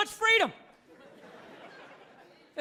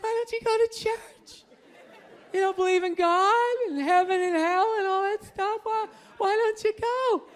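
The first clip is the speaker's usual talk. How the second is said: put-on higher, whiny pleading girlfriend; girlfriend's voice; 5 semitones higher, 3 dB louder than usual. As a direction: nag him the way his religious girl does. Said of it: "She's like".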